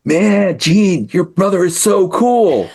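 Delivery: imitating an awestruck kid, high and drawn out; mock gushing in a high voice, no real whisper; awed kid. Other kids gush to him about his brother, high mock awe. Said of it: "come up to me and whisper".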